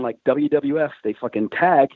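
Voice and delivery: ordinary talk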